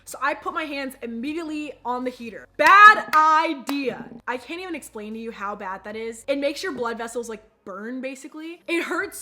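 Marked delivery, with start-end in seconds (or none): 2.6-4.1 slow, much louder, drawn out; emphatic warning. Real, not acted. Warning viewers about the heater, slow and loud. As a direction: lean hard on both words.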